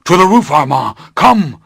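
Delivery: high, loud shout to Armand; urgent call. Acted. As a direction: call him up through the fire. Master calling Armand upstairs.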